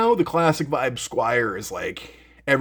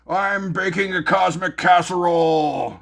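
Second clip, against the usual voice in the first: imitating Johnny; 4 semitones higher, 3 dB louder than usual. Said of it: "And he's like".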